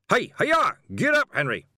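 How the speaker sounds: loud, high driving shout; yell. A narrator's reading. Carter urging on his horse, loud high yell. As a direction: holler the horse into action. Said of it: "took a deep breath and yelled".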